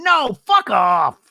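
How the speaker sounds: high, loud, drawn-out mock yell; imitated furious yell, words stretched; shouted comeback. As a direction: act out blowing up at someone. Acted quarrel, shouted comeback.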